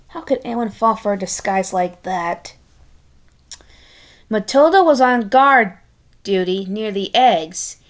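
ordinary delivery

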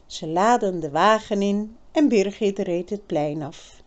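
usual speaking voice

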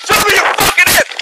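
sharp, high shouted command; shouted surrender demands. Real, not acted. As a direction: bark the order at him. Officer confronting suspect reported armed.

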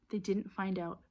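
ordinary delivery, nothing marked

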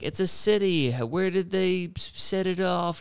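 high, amazed gasp of wonder; impressed onlooker. Retold or imitated. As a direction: gush like a dazzled tourist. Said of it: "it's impressive 'cause it's like".

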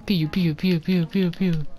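playful spy pistol sound effects; pew pew gun noises. Retold or imitated. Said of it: "Sounds like a fucking James Bond pistol".

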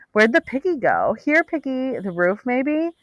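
high, teasing search calls; searching partygoers. A narrator's reading. Search party hunting her.

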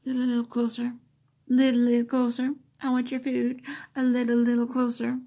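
be the cat creeping toward the food; voicing the cat. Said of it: "She inches, like".

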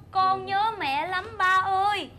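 put-on slow, loud echo of a child's lament; heart-rending imitation. Customer repeating child's plea to the father, heart-rending imitation. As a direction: draw the words right out, imitating a pining child. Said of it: "Nghe đứt ruột không?".

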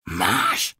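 ordinary talk

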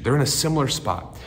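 ordinary talk, nothing marked

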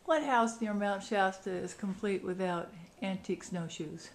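ordinary talk

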